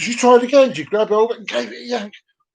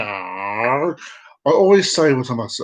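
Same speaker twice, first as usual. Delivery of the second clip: usual speaking voice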